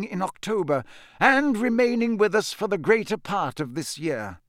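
high-pitched, measured oratory; Dumbledore, kindly headmaster. Acted. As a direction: address a whole hall as the old headmaster.

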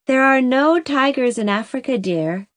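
ordinary delivery, nothing marked

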